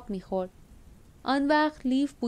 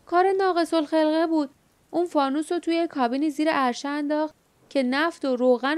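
raised, higher call over the storm; shouting; 6 semitones higher than usual. Acted. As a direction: shout the news over wind and rain. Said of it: "فریاد زد".

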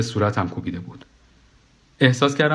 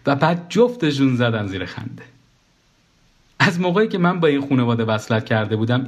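plain reading voice

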